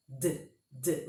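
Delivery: "D, D" as short bare consonant sounds, "duh"; isolated D sound. Shown as a demonstration. Teacher shows students the consonant's own sound, isolated "D, D". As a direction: release each D briefly and low, without an ee. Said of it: "O som é de D".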